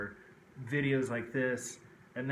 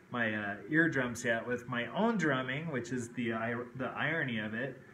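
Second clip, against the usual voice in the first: normal talking voice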